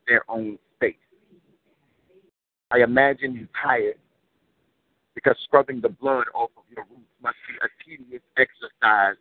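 rhythmic, weighty poem recital; spoken word poetry. Acted. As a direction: deliver it like a slam poem.

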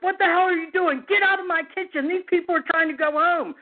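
imitating an outraged boss, high-pitched; irate manager. Manager orders cop out of kitchen, imitated outrage. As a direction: scold the intruder in a high, worked-up voice.

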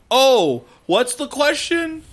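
high, slow, dopey "what's the question"; clueless player. Retold or imitated. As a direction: play the oblivious player who missed it. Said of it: "then they're like".

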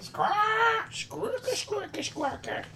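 very high, loud squawking; bird squawk. Acted. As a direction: screech it like a parrot. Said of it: "So your bird makes a sound".

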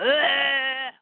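long acted groan of a struck guard; pained grunt. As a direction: groan as if taking a hit. Guard is hit in acted fight.

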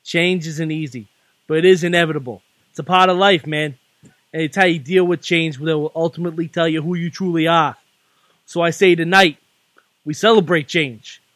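put-on wrestling promo read in a Boston accent; Boston-accented cult-leader promo; Bray Wyatt in Boston accent. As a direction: preach it in measured phrases, punching key words, Boston accent. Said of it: "reading a Bray Wyatt promo in a Boston accent".

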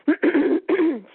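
clearing a hoarse throat. Real, not acted. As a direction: clear your throat roughly. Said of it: "sorry, losing my voice".

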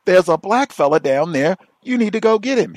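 high, loud insistent mock caller. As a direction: demand it like an impatient caller. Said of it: "He says".

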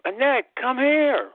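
high, slow call up the stairs; drawn-out summoning call; calling upstairs. Retold or imitated. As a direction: call her name from the bathroom. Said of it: "So I yelled up".

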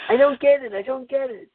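high, squeaky whine of frustration; frustrated cartoon duckling. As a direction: whine it like a baffled little duck. Duckling frustrated, pounding head.

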